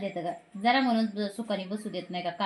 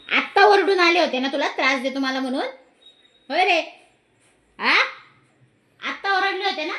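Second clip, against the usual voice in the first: higher, louder teasing sing-song; pet talk; 8 semitones higher, 6 dB louder than usual. Real, not acted. Grandmother teases the rabbit she scolded, high and loud.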